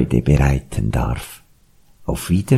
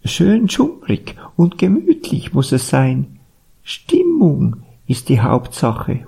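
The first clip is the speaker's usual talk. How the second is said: high owl character voice; high-pitched owl complaint; hooting owl; 10 semitones higher than usual. A narrator's reading. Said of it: "heulte die Eule".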